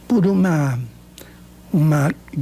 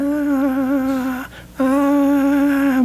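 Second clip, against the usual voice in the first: higher strained ah sounds imitating failed speech; imitated strained ah; 7 semitones higher than usual. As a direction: mimic someone straining to get a word out.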